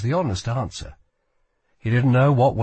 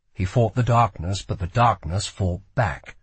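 usual speaking voice

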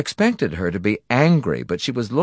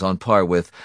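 ordinary delivery, nothing marked

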